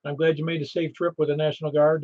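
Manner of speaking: usual speaking voice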